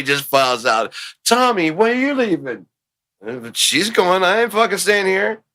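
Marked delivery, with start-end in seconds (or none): 1.2-5.4 lightly voiced worker, pitched up high; mock-indignant staff in a high voice; acted office workers. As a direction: act out a colleague joining the walkout. Acts out staff walking out.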